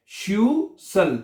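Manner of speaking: श्यूसल slowly split as "shyu... sal"; in two parts. Shown as a demonstration. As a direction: break श्यूसल after the first syllable. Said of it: "दो हिस्सों में करना है इसको".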